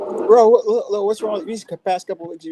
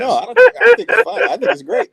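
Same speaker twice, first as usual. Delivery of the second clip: mock excited; 6 dB louder than usual. Real, not acted. Mock approval, laughing.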